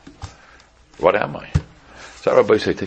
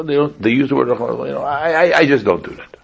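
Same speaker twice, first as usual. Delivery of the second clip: usual speaking voice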